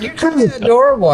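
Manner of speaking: high cooing voice. Retold or imitated. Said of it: "They're like".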